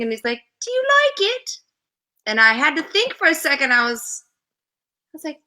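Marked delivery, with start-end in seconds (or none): 0.6-1.6 high-pitched poor accent imitation; bad John Anderson accent. Singer asking a model about her job, bad imitated accent. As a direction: put on a bad accent, voice well up. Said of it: "doing a very bad John Anderson accent".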